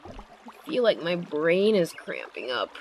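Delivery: ordinary talk, nothing marked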